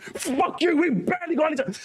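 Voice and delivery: high, sulky comeback; bitter Duskblood fan. Retold or imitated. Duskblood fan answering the others, high sulky retort. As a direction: snap back, sore but relieved. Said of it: "Duskblood guys are like".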